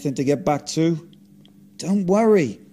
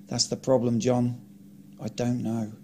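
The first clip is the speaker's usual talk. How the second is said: ordinary delivery, nothing marked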